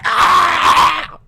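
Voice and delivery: mock yell. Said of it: "And he's like".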